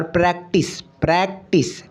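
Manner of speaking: प्रैक्टिस broken slowly as प्रैक-टिस; split into syllables. Shown as a demonstration. Teacher shows students two syllables, sounded out. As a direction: break प्रैक्टिस into two separate beats. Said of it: "यहाँ भी अपने को दो syllable".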